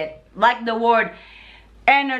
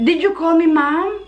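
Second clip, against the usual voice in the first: higher, retold puzzled question; mock being taken aback, pitch raised; coworker's surprised question; 6 semitones higher, 4 dB louder than usual. Coworker questions the newcomer's greeting, taken aback. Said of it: "She was like".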